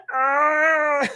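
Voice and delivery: long, high mock scream; hammed-up wail of terror; imitated scream. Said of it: "He literally starts screaming".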